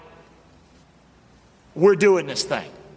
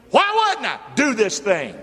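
preaching; 5 semitones higher, 4 dB louder than usual. Real, not acted.

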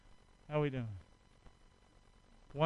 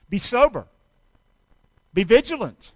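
preaching exhortation; 5 semitones higher, 11 dB louder than usual. Acted. Preacher urging his church to stay alert, in a loud exhortation. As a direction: punch each command out to the church.

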